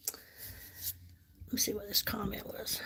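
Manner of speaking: whispered aside while reading a comment; hushed whisper to herself. Real, not acted. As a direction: breathe it under your voice, barely audible.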